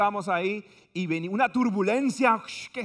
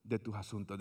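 low, soft fading ad voice; mock calm insurance spokesman; soothing insurance ad; 8 semitones lower, 10 dB quieter than usual. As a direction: sell it smoothly and quietly. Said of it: "decía la compañía de seguro".

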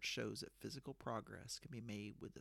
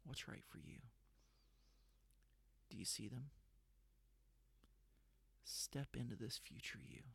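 guided meditation; 4 dB quieter than usual. Acted. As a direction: guide them gently into the vision.

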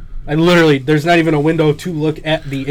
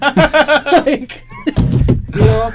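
laughing; 11 semitones higher, 5 dB louder, much slower than usual. Real, not acted. Laughing after airplane story.

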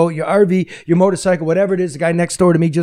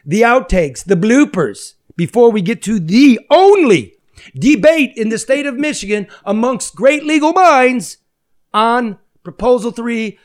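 high-pitched, big show-announcer build-up; slow down and stretch the key words like a ring announcer; grand, drawn-out billing of the main event; announcer intro; 9 semitones higher, 3 dB louder than usual. Acted.

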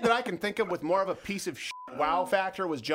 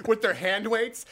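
ordinary delivery

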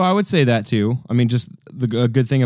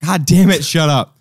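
loud exasperated snap; annoyed; 6 semitones higher, 6 dB louder, slower than usual. Real, not acted. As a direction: tell him off loudly. Annoyed at porn joke.

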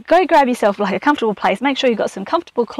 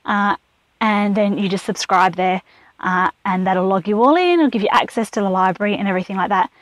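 ordinary talk

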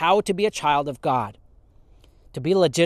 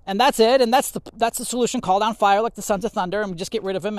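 mock stubborn refusal; 6 semitones higher than usual. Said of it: "Don't say".

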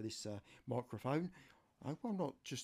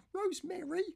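much higher falsetto voice for his niece; niece's voice. Retold or imitated. Niece phoning her uncle for help, high falsetto. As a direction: play the niece asking a favour, pitched way up. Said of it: "no, got it wrong".

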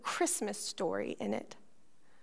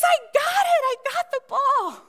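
put-on high, loud, thrilled child's cry; a four-year-old holding up his ball, thrilled; triumphant little boy; 12 semitones higher, 7 dB louder than usual. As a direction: announce the catch proudly.

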